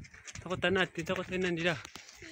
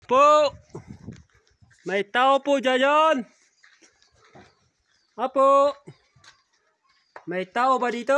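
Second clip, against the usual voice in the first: loud call across the yard; calling from far away; 8 semitones higher, 8 dB louder than usual. Real, not acted. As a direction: call out to the house. Calling out at a house.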